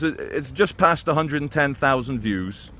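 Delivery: ordinary talk, nothing marked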